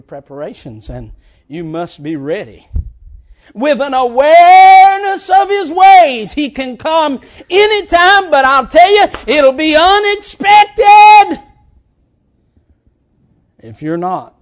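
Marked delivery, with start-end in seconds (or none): 3.5-11.4 loud, high preacher's shout, slowing at the end; shouted preaching. Acted. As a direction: drive the warning home to the church. Preaching at full force.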